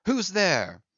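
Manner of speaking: sharp, loud startled challenge; startled call. A narrator's reading. Starbuck calling out startled into the storm. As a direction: call out into the dark, alarmed.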